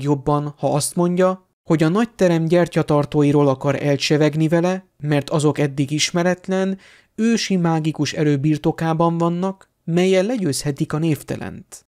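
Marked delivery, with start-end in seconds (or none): none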